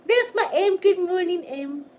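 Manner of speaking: squealing good morning to her pet; excited greeting. Real, not acted.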